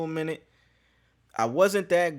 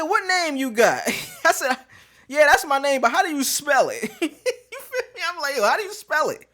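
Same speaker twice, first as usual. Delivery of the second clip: higher and louder, laughing through it; laughing disbelief; 10 semitones higher, 5 dB louder than usual. Retold or imitated. Grilling the doctor about his name, laughing disbelief. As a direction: question him through laughter. Mocking retold phone questions.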